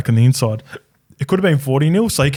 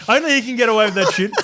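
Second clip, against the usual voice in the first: said through a real laugh, higher; 9 semitones higher than usual. Real, not acted. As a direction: laugh the words out. Laughing at coach's joke.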